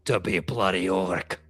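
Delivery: low, weighty orc line; orc war leader. Acted. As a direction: drop low to finish the rally. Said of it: "and he goes".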